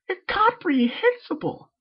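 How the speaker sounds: high, slow, drawn-out squeal; dramatic cry. Acted. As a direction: stretch the word theatrically. Pinkie popping up dramatically.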